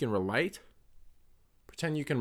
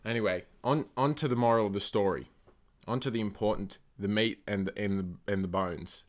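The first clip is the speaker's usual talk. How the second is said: normal talking voice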